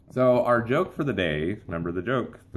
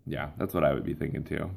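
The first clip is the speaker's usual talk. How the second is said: ordinary talk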